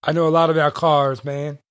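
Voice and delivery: ordinary talk, nothing marked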